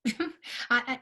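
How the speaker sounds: stammered through a brief laugh. Real, not acted. Coach explaining herself to participants, laughing. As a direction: start to speak through a chuckle. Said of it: "I'm not laughing to laugh".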